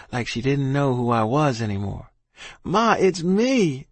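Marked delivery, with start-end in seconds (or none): 2.6-3.9 high, slow, stretched-out cry; shouted plea. A narrator's reading. Boy reaching his confused dying ma, shouted plea. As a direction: plead high and drawn out to be recognized. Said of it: "I shouted".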